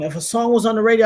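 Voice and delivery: ordinary talk, nothing marked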